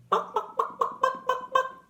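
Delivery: high clucking bok bok bok; chicken clucking. Shown as a demonstration. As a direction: cluck like a hen, high and quick. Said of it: "Make a noise like a chicken".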